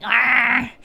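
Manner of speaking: mock loud hype shout; Nano Dan's stream voice. Nano Dan on stream hyping chat, loud mock shout. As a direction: belt it like a hyped streamer going live. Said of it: "when he streams, he's like".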